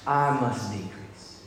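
very slow, hushed quoting of the verse; slow reverent hush; slow and soft. Shown as a demonstration. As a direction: let each word land, quietly. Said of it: "Here's what it says".

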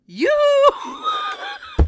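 very high, laughing singsong call; sing-song yoo-hoo. Retold or imitated. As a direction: sing it out like a playful ghost. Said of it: "I hear".

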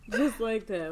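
high, loud words through laughter; laughing. Real, not acted. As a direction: repeat it while cracking up. Cracking up at own joke.

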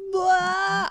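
high, drawn-out blah; silly high-pitched mock scream; imitated group yell. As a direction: mimic everyone crying out. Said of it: "and then they all go".

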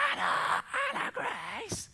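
half-voiced, strained call for a lost child; mock a desperate shout of her name; retold shouting. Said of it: "like, shouting".

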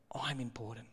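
breathy whisper of a smug claim; whispered mock boast. Preacher mocks the Pharisees, whispering smugly. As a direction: hush it like a petty boast.

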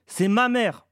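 high, loud outraged shout; forceful vociferation. A narrator's reading. Boy breaks free to save his mother, shouting. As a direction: bellow it in protest. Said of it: "vociféra-t-il avec force".

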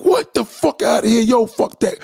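high-pitched outraged outburst; mad. Acted. Client reacting to passenger's confession, high-pitched and mad. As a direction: pitch jumps up in indignant disbelief. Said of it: "'Cause now he's mad".

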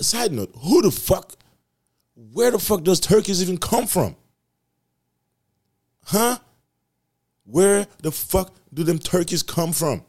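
loud, high exasperated rant; incredulous rant. Real, not acted. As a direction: demand it like it's outrageous. Ranting about turkeys.

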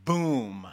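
low, round boom; explosion sound word. Shown as a demonstration. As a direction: let it land like an explosion. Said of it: "Here's another one".

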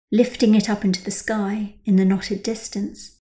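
ordinary delivery, nothing marked

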